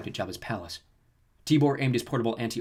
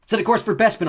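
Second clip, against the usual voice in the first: loud, high commanding call; shouted order; 10 semitones higher, 9 dB louder than usual. Acted. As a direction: bark the order at the droid. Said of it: "Luke shouted".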